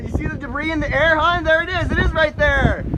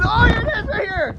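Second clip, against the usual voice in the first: excited; 9 semitones higher than usual. Real, not acted. Spots tornado again.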